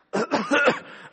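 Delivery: hammed-up sickly cough, laughing; fake cough. Worker faking illness to the boss, put-on cough. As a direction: cough for the boss's benefit. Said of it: "you've got that sick voice".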